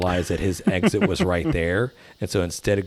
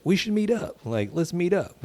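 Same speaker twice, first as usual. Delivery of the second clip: high eager voice; 10 semitones higher than usual. Retold or imitated. John's invitation quoted.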